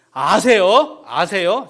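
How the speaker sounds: loud, high, firm declaration; booming sermon affirmation; emphatic answer. Real, not acted. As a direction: proclaim it to the congregation. Preacher answers his own question.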